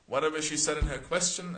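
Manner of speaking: ordinary talk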